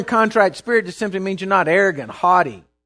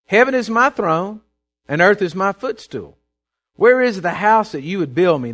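raised, forceful Bible reading; scripture proclamation; 3 semitones higher, 5 dB louder than usual. Acted. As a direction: proclaim God's words to the congregation. Reads God's words aloud.

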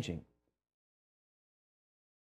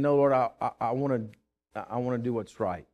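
softer, lower, surrendering to the Lord; humble prayer; 5 semitones lower, 4 dB quieter than usual. Retold or imitated. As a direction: quietly give in to God. Surrendering prayer voiced in story.

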